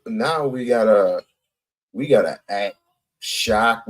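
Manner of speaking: ordinary delivery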